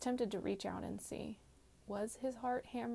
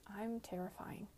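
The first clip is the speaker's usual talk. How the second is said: whispering; 7 dB quieter than usual. A narrator's reading. Confession after tense pause.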